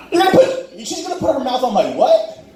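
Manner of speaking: high-pitched comic disbelief; incredulous shock. Retold or imitated. He questions what she will do, high incredulous shock. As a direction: question it in a high, scandalized squeal. Incredulous reaction to the sex talk.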